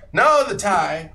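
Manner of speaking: loud, high, drawn-out correction; emphatic insistence. Acted. Lantern man turns down hats for the tie, insistent. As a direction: cut in loudly, stretching the words.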